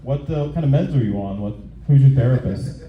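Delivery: normal talking voice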